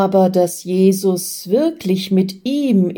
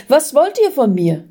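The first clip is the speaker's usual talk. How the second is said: higher-pitched, alarmed question; startled; 6 semitones higher than usual. A narrator's reading. Man confronting dark figures in the room, startled. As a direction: demand it with a jolt of fright. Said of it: "fragt der Mensch erschrocken".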